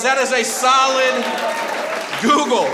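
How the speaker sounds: loud, high praise to the crowd; big hype for the crowd; cheering shout. Acted. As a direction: hype the audience up.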